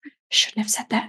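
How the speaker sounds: quick guilty whisper of regret; imitating the teacher in a mock whisper. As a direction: hush it out like a shamed confession.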